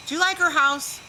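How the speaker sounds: high, pointed gossip voice; gossiping friend needling about the house, high and pointed; catty gossip question. Retold or imitated. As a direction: dig at the neighbor's house, sly and high.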